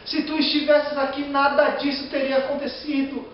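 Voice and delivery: high, loud lament, voice straining upward; anguished plea. Acted. Preacher voicing Mary pleading at Jesus' feet, high anguished cry. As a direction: cry it out to the Lord as the grieving sister. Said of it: "Falou a mesma coisa que eu".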